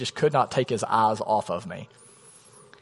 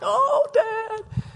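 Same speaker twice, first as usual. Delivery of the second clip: high, laughing-crying sob of a voice. Retold or imitated. Son reunited with his father, crying and laughing. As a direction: choke out the word through happy tears. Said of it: "The one who's crying".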